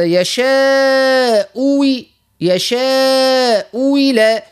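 slow "يشاء إلى" with "إلى" sounding like "وِلى"; hamza turned into waw. Shown as a demonstration. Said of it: "نبدلوا الهمزة الثانية بواو خالصة". ("يشاء إلى" ببطء مع إبدال الهمزة واوًا)

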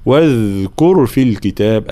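slow chant, "واذكر" with lingering voiced dh; soft voiced dhal. Shown as a demonstration. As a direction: let the dh of "واذكر" flow. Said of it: "الذال دائمًا صفة الرخاوة خاصة". (واذكر بذال رخوة مجهورة)

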